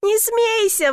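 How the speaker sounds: high-pitched plea; pleading. A narrator's reading. Stuck man begging laughing woman, pleading. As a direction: beg her to stop. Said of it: "взмолился я".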